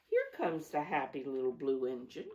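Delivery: ordinary delivery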